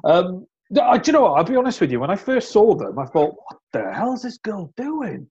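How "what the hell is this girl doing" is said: high-pitched, drawn-out disbelief; mock-appalled amazement, voice lifted high; incredulous. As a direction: recount being utterly baffled. Retells first shocked reaction to videos.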